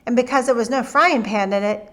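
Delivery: ordinary delivery, nothing marked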